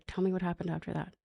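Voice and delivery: put-on quick, hushed prompt to a child; soft prompting. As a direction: quietly coax out the next detail. Retold prompting of daughter for statement.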